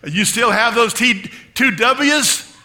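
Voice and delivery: imitating an eager question at high volume; loud retold question. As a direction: call it out teasingly. Retold loud question to elderly woman.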